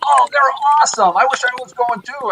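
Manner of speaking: light up like a fan meeting a fan; mock-gushing border guard; excited fan. Guard gushes about the band.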